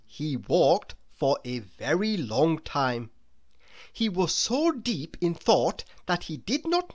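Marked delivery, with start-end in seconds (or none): none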